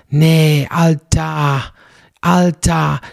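"Alter" said like "Altar" without the R; "Alter" with a loud, long open final A; drawn-out Alter. Shown as a demonstration. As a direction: stretch "Alter" into a long "al-TAA". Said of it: "also Altar ohne R".

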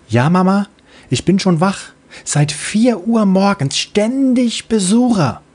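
put-on high, bright young girl's voice; little child voice. Little Freebie replying to her mother, high childlike voice. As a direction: play a sleepy little kid answering mom.

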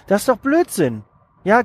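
ordinary talk, nothing marked

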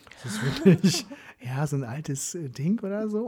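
amused laugh over the words. Real, not acted. Lacht über altes Handy.

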